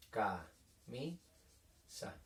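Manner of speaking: camisa very slowly and softly, ca... mi... sa with long gaps; syllable by syllable. Shown as a demonstration. Teacher writing a three-syllable word, camisa slowly split into ca, mi, sa. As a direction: break camisa into three quiet, separate syllables. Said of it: "Tres golpes de voz".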